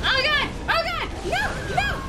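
high, loud panicked yelps; sudden terrified shouts; panicked yelling. Real, not acted. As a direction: scream it as the monster lands on you. Panicked yelps at enemy attack.

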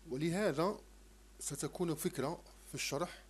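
ordinary talk

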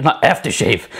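strained, cracking voice; the voice gives out mid-word; voice going odd. Real, not acted. Baker losing her voice mid-recipe, strained and cracking. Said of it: "where's my voice going".

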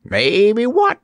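high, wheezy question; wheezing dummy. A narrator's reading. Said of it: "wheezed the dummy".

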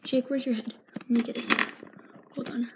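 ordinary talk, nothing marked

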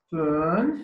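very slow, split into separate parts; slow number model. Shown as a demonstration. Teacher breaking down a learner's age in German, very slow and segmented. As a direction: spell out the number sound by sound, with gaps.

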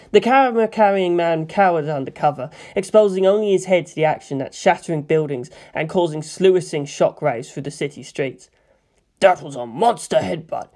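ordinary delivery, nothing marked

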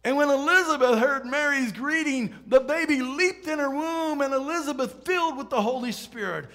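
loud, high preaching proclamation; fervent scripture reading. A narrator's reading. Preacher reads scripture with raised fervor.

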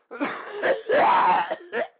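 loud, high, hacking cough fit; a strained coughing fit. Acted. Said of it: "I'm coughing up a lung here".

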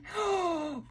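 soft, breathy mock gasp; hushed little storybook gasp; playful gasp. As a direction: gasp as if spotting something. Mock surprise reading to baby.

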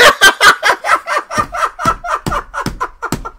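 long, very high trailing laugh; hysterical laughter. Real, not acted. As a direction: collapse into cackles. Laughs at the fake account.

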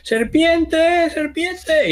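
very high-pitched cry of warning; lookout's high yell; alarm shout. Acted. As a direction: raise the alarm in a high, drawn-out call. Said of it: "gritaría todo lo alto que pueda".

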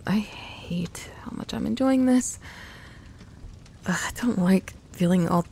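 hushed, reluctant confession; soft whisper. Acted. Girl in her partner's arms whispering a reluctant confession. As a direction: whisper it as if you don't want to admit it. Admitting she enjoys cuddling.

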